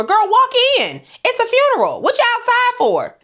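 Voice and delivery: put-on high, exasperated call to the kids; sassy scolding. Host scolding the peeping children, high and exasperated. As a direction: shoo them in like an auntie.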